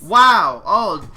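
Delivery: loud, high burst of surprise; surprised wow. Real, not acted. Streamer startled that a dead character is alive, loud surprised wow. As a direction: blurt it out, genuinely startled.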